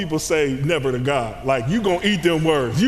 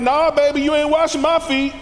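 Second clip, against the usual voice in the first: higher, louder sassy refusal; Peter refusing; 9 semitones higher, 5 dB louder than usual. Retold or imitated. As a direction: brush Jesus off, high and loud. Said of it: "And Peter's like".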